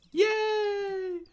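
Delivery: shrieked high yay; squealing cheer. Real, not acted. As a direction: cheer like you just won. Cheer in game.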